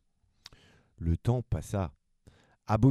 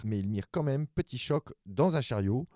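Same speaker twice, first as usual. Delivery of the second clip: plain reading voice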